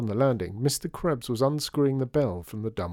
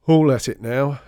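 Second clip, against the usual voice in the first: loud, grumpy complaint; grumbled; 3 semitones higher, 11 dB louder than usual. A narrator's reading. Caretaker complaining about everyone in the building, grumbled. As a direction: grumble it out forcefully. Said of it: "he grumbled".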